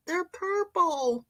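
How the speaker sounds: high, slow wondering "They're purple"; delighted surprise. Real, not acted. Host admiring a viewer's elephant, high and amazed. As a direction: marvel at the purple eyes.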